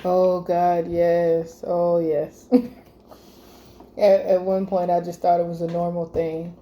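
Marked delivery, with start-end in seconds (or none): none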